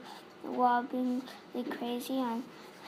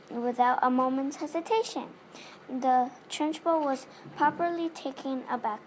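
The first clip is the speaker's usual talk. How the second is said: ordinary delivery, nothing marked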